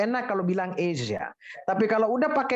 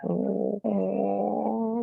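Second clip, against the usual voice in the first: ordinary talk, nothing marked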